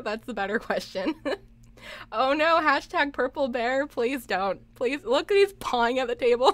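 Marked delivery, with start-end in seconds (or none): none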